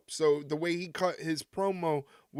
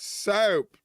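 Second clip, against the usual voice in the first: mock Southern accent; 3 semitones higher, 4 dB louder than usual. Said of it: "that's how people down south in Tennessee talk".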